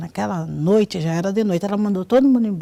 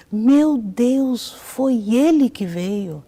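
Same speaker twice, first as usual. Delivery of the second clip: raised, drawn-out exclamation; mild imitated shout, higher and louder at the start; retold cry out; 3 semitones higher, 4 dB louder, much slower than usual. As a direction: imitate her astonished cry, slowing at the end. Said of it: "ela deu um grito".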